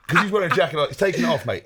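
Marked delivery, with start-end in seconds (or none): none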